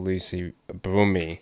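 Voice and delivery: slowly spelling out the name; slow repeat. Retold or imitated. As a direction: say the name slowly, syllable by syllable, as if checking it. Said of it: "So she said".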